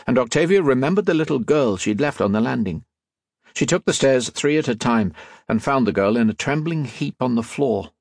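ordinary delivery, nothing marked

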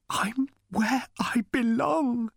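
slow, breathless whisper full of feeling; emotional whisper. A narrator's reading. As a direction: whisper it, moved almost to tears. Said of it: "in an emotional whisper".

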